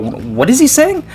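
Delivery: high, slow, puzzled mock question; acted bafflement. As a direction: stretch it out and climb high, as if totally lost. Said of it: "to try and figure out".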